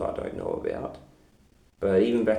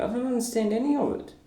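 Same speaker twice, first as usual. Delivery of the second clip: fast confused; 13 semitones higher, faster than usual. Real, not acted. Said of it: "So I'm very confused".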